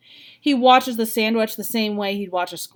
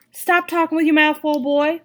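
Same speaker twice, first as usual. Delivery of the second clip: mother scolding her son, louder and higher; 7 semitones higher, 6 dB louder than usual. A narrator's reading. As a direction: snap at him to stop talking with his mouth full. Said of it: "Mama scolds".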